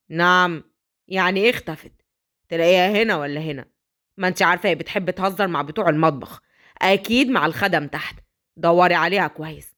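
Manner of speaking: low, offhand brush-off; dismissive father. Acted. Father brushing off the nanny's fear, low and dismissive. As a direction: wave her worry away.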